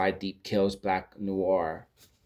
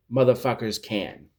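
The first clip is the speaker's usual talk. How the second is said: normal talking voice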